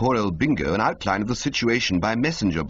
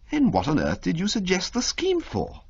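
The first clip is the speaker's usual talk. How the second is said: higher, incredulous question; baffled toff; 11 semitones higher than usual. Acted. Bertie to Jeeves, higher baffled toff voice. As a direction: demand an explanation.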